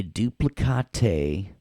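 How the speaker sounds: "duplicate" said as doo-plih-kah-TAY; mock-fancy "duplicate" ending in a long TAY; playful mispronunciation. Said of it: "way more fun to say duplicate".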